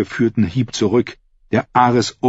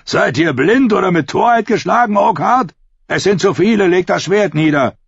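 hohe, kräftige Figurenstimme; spöttischer Zuruf; 10 semitones higher than usual. A narrator's reading. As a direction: ruf dem Ritter höhnisch zu, aufzugeben. Dunkelstern verhöhnt den trotzigen Ritter. (hohes, spöttisches Rufen)

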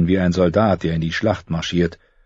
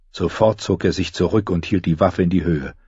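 normal talking voice

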